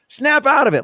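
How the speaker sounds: high, loud snap out of it. Retold or imitated. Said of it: "like [name] did in Moonstruck".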